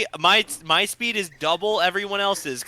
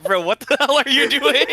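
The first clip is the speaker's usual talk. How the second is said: incredulous; 9 semitones higher, 5 dB louder than usual. Retold or imitated. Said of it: "And I was like".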